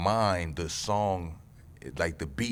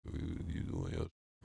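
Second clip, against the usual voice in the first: low, whispered and much softer; hushed whisper; 12 semitones lower, 12 dB quieter than usual. Real, not acted. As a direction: drop it to an impressed whisper. Repeats the praise, hushed.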